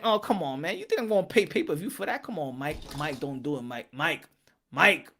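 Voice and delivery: mildly disappointed groan; disappointed fan. Retold or imitated. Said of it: "nobody wouldn't be like".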